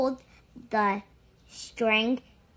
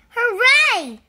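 high loud hooray; cheering; 11 semitones higher, 7 dB louder than usual. A narrator's reading. Narrator cheers as the rescuer appears, high and loud. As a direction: cheer with joy.

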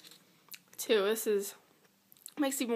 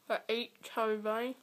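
cheeks stuffed full; 4 dB quieter than usual. Real, not acted. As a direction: mouth full of marshmallows. Chubby bunny, mouth full of marshmallows.

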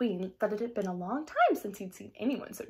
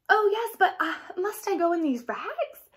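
put-on higher, slower girl asking shyly; lightly voiced young girl, unsure about her rags; Cinderella, hesitant; 8 semitones higher, 3 dB louder than usual. A narrator's reading. Cinderella answers the godmother.

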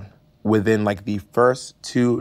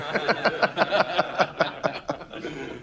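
laughing; 7 semitones higher, 5 dB quieter than usual. Real, not acted. Laugh after punchline.

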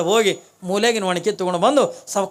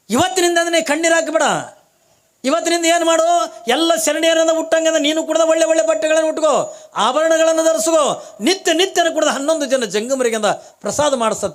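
high, loud proclamation; booming heavenly voice; 8 semitones higher, 4 dB louder than usual. Acted. Heavenly voice proclaims to Kalavve, booming. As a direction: proclaim from the sky. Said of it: "Akashvani".

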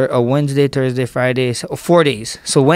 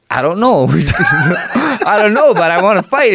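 laughing through it; 6 semitones higher, slower than usual. Retold or imitated. Laughing nervously at mom's question.

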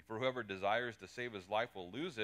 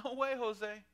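flippant denial; 12 semitones higher, 9 dB louder than usual. Acted. Peter's acted denial.

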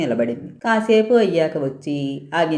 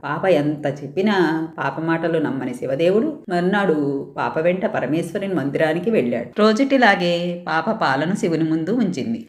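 plain reading voice

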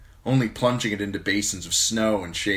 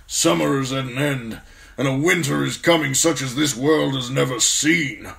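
put-on loud, high old officer's voice with foreboding; old commander, grim and forceful; 7 semitones higher, 7 dB louder than usual. Commander warns young man of the coming winter, loud and grim. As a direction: warn of dark times as a battle-worn commander.

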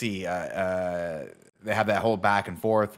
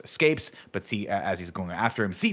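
ordinary delivery

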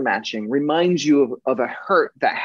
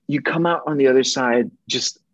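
normal talking voice

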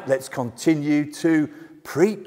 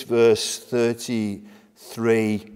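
ordinary talk, nothing marked

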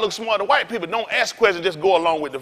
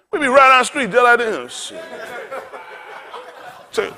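normal talking voice

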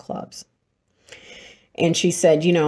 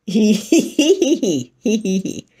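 practiced hee-hee laugh; 3 semitones higher, 5 dB louder, slower than usual. Retold or imitated. Demonstrating the next laughing exercise, practiced hee-hee giggle. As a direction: run of deliberate hee-hees. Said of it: "The next one is".

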